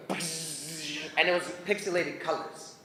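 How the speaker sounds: ordinary talk, nothing marked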